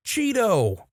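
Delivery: high, falling cry without much volume; mock yell of exasperation. A narrator's reading. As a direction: call out the name as if scolding. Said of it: "and an even louder".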